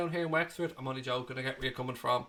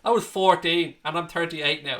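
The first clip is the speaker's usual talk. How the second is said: ordinary talk, nothing marked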